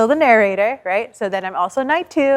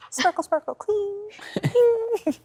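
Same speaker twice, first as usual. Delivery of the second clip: high sing-song; 11 semitones higher, 5 dB quieter, slower than usual. Acted. Mocking a pristine knight.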